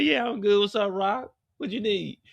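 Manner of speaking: Tremont in a high, put-on voice; Tremont, high-voiced. Tremont greets Rock in a high, cocky voice. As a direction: play him high and cocky. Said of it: "He said".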